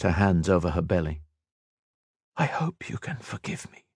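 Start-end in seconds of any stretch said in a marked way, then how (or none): 2.4-3.8 soft breathy whisper. Acted. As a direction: whisper a private plea. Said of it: "she whispered".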